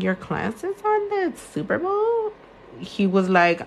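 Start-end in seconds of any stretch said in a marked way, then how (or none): 0.0-2.3 quoted question. Retold or imitated.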